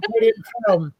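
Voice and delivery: real high laugh running into the words. Real, not acted. Teller amused by own film heckling, real laughing. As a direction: crack up, then say it through the laugh.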